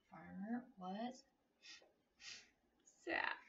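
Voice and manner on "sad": plain reading voice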